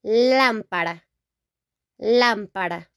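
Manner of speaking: lámpara stressed on the first lá; teacher modelling lámpara; Spanish pronunciation. Shown as a demonstration. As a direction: say lámpara with a tapped r. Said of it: "Here we say".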